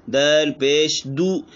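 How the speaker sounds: slow letter-by-letter दाल पेश दू; drawn out दू; spelling out. Shown as a demonstration. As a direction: teach दू clearly. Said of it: "ये पेश की मिसाल है".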